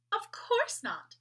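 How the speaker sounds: put-on high, slow, scoffing doll girl; big sister doll. A narrator's reading. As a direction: brush off the silly question.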